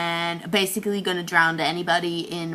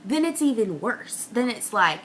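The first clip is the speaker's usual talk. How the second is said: ordinary talk, nothing marked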